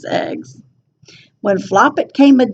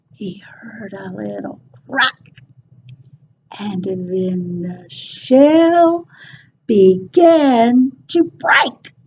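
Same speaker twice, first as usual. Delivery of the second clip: suspense; 4 semitones lower than usual. A narrator's reading. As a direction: whisper as the shell cracks. Suspense before the egg hatches.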